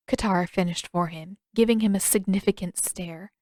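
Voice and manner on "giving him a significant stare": ordinary delivery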